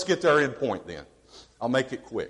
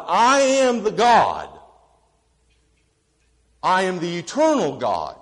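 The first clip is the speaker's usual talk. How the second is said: God declaring; 4 semitones higher, 8 dB louder than usual. Retold or imitated. God answering Moses at the bush, booming proclamation. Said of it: "And God said".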